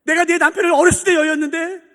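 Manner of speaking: high, loud, earnest cry to God; impassioned questioning prayer. Shown as a demonstration. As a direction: plead the question at full voice. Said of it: "라고 질문해 보세요".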